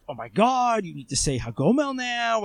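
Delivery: high-pitched imitation of fussing; mock alarmed reaction. People reacting to his Facebook post, imitated alarm. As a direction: lightly mimic people making a fuss.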